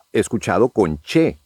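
usual speaking voice

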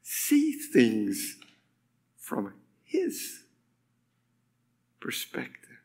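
soft words fading to a whisper, long pauses; hushed scripture reading. Acted. Preacher closing the Colossians passage, hushed. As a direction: trail down into a reverent whisper. Scripture line ends in hush.

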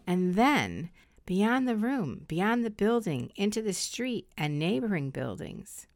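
slow, soft meditation voice; soft spa whisper. Acted. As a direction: guide them into calm, slowly. Leads a guided meditation.

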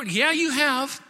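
high, loud grudging admission; acted heated concession. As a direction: give in while still arguing loudly. Balaam concedes in the heated argument.

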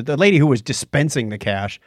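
normal talking voice

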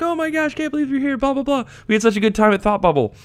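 high, squealing fan gush; mock high-pitched excitement; gushing greeting. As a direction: imitate friends squealing with delight at a reunion. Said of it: "and everyone's like".

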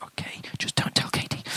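hushed unvoiced whisper; conspiratorial aside; whispered plea. Dad begging God to keep it from his wife, whispering.

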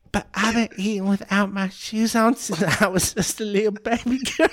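put-on high, laughing baby-girl voice. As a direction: whine it like a toddler. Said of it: "She's like".

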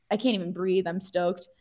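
ordinary delivery

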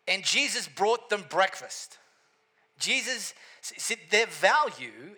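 loud, high-pitched pulpit preaching; full-force preaching. Acted. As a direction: preach it out to the whole room with fire.

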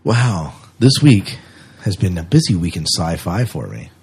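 ordinary talk, nothing marked

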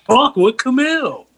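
slow, high, drawn-out show title; mock show announcer. Host jokingly names cohost's talk show, mock announcer. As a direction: stretch out the pretend show's name.

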